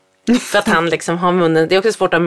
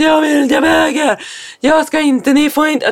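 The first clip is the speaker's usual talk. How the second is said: mock angry yell; 8 semitones higher than usual. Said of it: "bara skriker så jätte".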